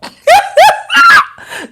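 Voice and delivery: high, loud burst of laughter. Real, not acted. Joking that Kaima is a girl, high laugh. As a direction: shriek with laughter.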